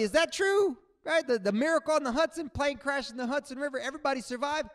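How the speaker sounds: ordinary delivery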